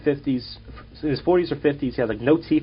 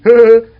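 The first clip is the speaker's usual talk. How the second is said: mock cackle; 10 semitones higher, 14 dB louder than usual. Heckler laughs triumphantly.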